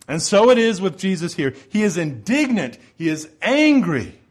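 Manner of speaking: rising pulpit fervor on Jesus' anger; fervent preaching. Acted. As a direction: preach it hard, land every word. Preaching at full force.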